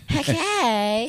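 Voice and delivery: high, exaggerated effeminate caricature voice.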